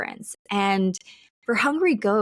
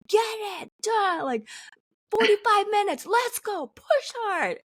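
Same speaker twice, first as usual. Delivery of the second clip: high-pitched mock coach yell; instructor yelling; 12 semitones higher than usual. Workout instructor yelling at class, mock yell. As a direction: pump them up like a fitness instructor. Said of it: "yell and be like".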